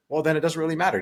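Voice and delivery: usual speaking voice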